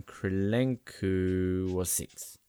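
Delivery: ordinary talk, nothing marked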